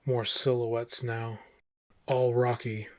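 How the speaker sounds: ordinary delivery, nothing marked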